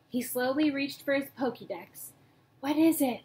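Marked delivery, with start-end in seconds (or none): none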